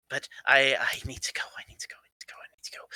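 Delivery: hushed, repetitive whisper fading away; frantic whispering. Acted. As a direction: whisper it over and over, fading to almost nothing. Character mutters urgently to himself.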